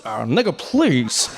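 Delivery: a light Obama brush-off with a chuckle. Retold or imitated. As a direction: wave it off coolly.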